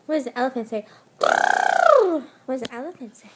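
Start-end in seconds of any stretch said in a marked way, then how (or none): 1.2-3.3 put-on asked again much louder to prompt the baby; louder coaxing. Shown as a demonstration. Mother loudly prompting her baby to make the elephant sound. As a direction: call it out to get the baby going. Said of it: "can you do your elephant sound".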